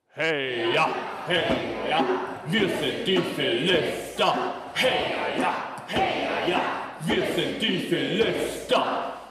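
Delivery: lauter, langsamer Sprechchor der Feinde; brüllt den Kampfruf wie ein höhnisches Heer; grölender Schlachtruf. Acted. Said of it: "sie rufen". (Philister grölen ihren Schlachtruf zu den Israeliten hinüber, laut und langsam)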